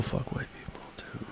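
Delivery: hushed whisper. Acted. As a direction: whisper it like a secret. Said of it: "Why are we whispering?".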